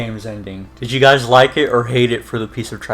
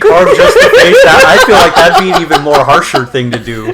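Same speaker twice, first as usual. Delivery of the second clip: laughing while talking; amused, laughing it out; 5 semitones higher, 11 dB louder than usual. Real, not acted. Laughing over Thor scene.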